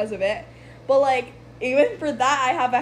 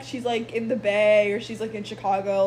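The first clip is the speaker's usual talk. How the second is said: ordinary delivery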